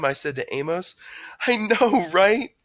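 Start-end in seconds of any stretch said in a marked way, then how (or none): 1.5-2.6 high, loud amused reply; gleeful agreement; laughed reply. A narrator's reading. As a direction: say it as if laughing along. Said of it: "laughed Amos".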